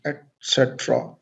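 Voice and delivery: et cetera as "et SET-ra", T sounded; correct pronunciation. Shown as a demonstration. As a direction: clear T before the S in et cetera. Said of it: "This is called". (et cetera का सही उच्चारण, "एट सेट्रा")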